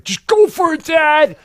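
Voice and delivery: put-on high, loud child's voice urging dad on; little daughter voice. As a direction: play the small girl egging him on. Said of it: "and she's like".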